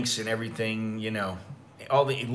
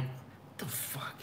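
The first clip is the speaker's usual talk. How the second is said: breathless whisper, slow; whispered what the fuck; 16 dB quieter, slower than usual. Retold or imitated. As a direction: hiss it under the breath, half asleep. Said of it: "And I'm like".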